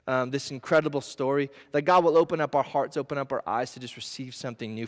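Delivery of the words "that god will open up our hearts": ordinary talk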